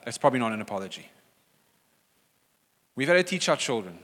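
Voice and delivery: ordinary delivery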